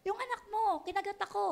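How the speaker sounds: a hint of an indignant lady; complaining woman. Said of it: "Sabi niya".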